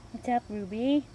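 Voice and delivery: ordinary talk, nothing marked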